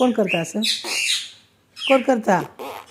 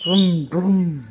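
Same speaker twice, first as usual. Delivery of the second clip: imitated engine noise; 2 semitones lower than usual. Shown as a demonstration. Showing child how papa's vehicle sounds, imitated engine vroom. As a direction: mimic papa's vehicle revving. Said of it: "कसा आवाज करते?".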